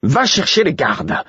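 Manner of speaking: slow, high order just short of yelling; presque hurlé. A narrator's reading. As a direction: stretch the order out, on the verge of a yell. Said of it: "Peu s'en était fallu que Murfess ne hurle". (ordre exaspéré, au bord du cri)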